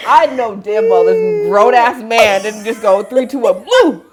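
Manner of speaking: loud, high, cracking up; incredulous outburst through real laughter; laughing disbelief. Real, not acted. Friend retelling a grown man's countdown, laughing incredulously.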